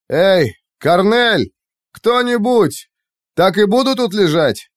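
high, loud shout for help; calling out. A narrator's reading. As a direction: yell across the room.

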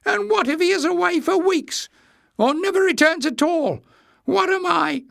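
very high, fretful squeak; high-pitched agitation. A narrator's reading. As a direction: squeak the worried questions. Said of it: "He squeaked".

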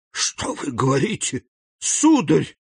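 испуганный возглас, «сударь» выше; вскрикни в ужасе; exclaiming. A narrator's reading. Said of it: "воскликнула госпожа де Вильфор". (жена в ужасе восклицает)

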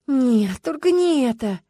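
high-pitched groan of dismay; moaning plea. A narrator's reading. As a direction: wail it in pleading protest. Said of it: "Люба тут же простонала". (простонать умоляюще)

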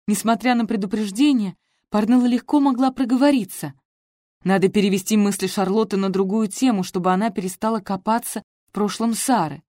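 ordinary delivery, nothing marked

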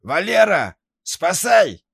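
high, loud panicked cry for help; terrified scream. Acted. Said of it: "вырвался дикий крик".

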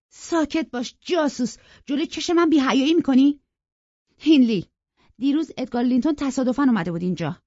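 high, loud, indignant outburst; angry shouting. A narrator's reading. Young woman silencing the spying servant before her brother, shouting. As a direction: shout down the informer, voice pitched right up. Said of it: "کاترین داد زد".

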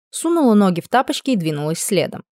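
plain reading voice

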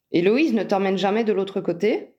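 usual speaking voice

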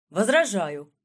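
sharp, loud "I object"; loud objection. Acted. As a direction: cut in loudly from your seat. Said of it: "громко заявил молодой юрист".

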